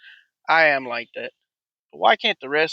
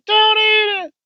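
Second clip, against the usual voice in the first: put-on high-pitched, louder child's shriek, stretched out slowly; mock kid scream; 7 dB louder, slower than usual. Little boy yelling at his friend at lunch, high shriek. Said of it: "He's like".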